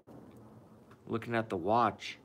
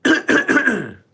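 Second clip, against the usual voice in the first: throat clearing; 12 semitones higher, 13 dB louder than usual. Real, not acted.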